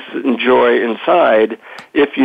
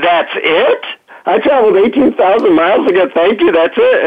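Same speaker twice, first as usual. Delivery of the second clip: retold disbelief, pitched up; incredulous protest; 7 semitones higher than usual. Disappointed traveler challenging the guru, incredulous. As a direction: protest like you have been short-changed.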